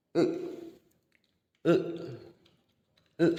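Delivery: "e" voiced from the base of the throat, mouth not opened wide; vowel e demo. Shown as a demonstration. Teacher showing students the vowel sound, drawn from the throat. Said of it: "bunyi ini e, ya".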